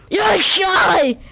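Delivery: high shouted yes; excited shout. Real, not acted. Shouting in triumph during the game. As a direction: yell it out in triumph. Cheering a win in play.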